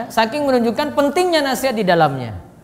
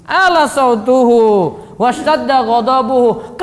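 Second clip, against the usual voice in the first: loud, slow, drawn-out declamation; forceful recitation; 4 dB louder, slower than usual. Shown as a demonstration. Teacher showing the Prophet's angry sermon, forceful declaiming. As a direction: proclaim it with long vowels. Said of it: "Nabi contohkan ini".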